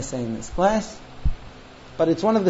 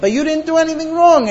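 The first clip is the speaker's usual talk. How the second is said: high-pitched mock protest; indignant protest; 9 semitones higher, 4 dB louder than usual. Husband told to apologize to crying wife, high indignant mock protest. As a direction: insist on innocence, voice jumping up.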